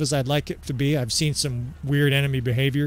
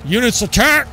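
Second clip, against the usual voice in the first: mock battle shout, high and loud, into a dying "oh"; yelled command cut short; 9 semitones higher, 7 dB louder than usual. Player imitating an enemy lord killed mid-order, yelled command cut short. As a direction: bellow an order, then get hit halfway through. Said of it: "is yelling out a command and he gets killed halfway".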